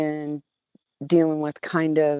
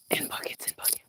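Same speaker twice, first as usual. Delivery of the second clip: playful whisper; 14 dB quieter than usual. Real, not acted. Rain talk dropped to whisper.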